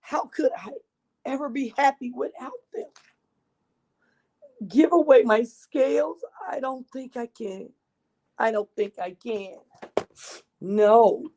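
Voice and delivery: put-on lively read-aloud for children; storybook narration. A narrator's reading. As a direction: tell it like a picture book to a class. Reads picture book to children.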